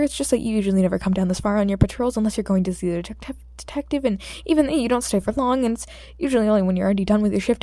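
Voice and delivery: fast loud babbling; rambling. Acted. Nervous boy rambles the hero's patrol secrets out loud. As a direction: tumble the words out nervously. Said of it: "yelling out the secrets".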